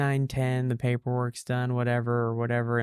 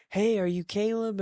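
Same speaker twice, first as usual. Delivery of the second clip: dentist calling out; 8 semitones higher than usual. Retold or imitated. Dentist voiced calling over.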